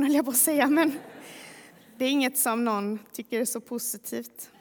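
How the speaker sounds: ordinary delivery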